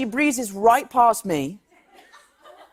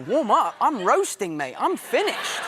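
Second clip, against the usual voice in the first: exasperated; 5 semitones higher, slower than usual. Retold or imitated. Tired actor refuses trainer's treadmill warm-up, exasperated. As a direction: protest in comic disbelief, pitch shooting up at the end. Retold protest at the gym.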